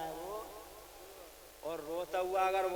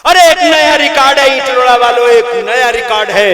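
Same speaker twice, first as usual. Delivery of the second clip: loud proclamation; 7 semitones higher, 28 dB louder than usual. Acted. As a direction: shout it out to the whole crowd, much louder. Preacher shouts to the crowd. (ज़ोर से ऐलान करते हुए)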